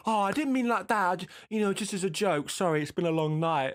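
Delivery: high, apologetic coworker voice, lightly done; imitating coworker. Coworker apologising for his joke, in a mock high voice. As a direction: squeak out a flustered apology. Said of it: "he was going".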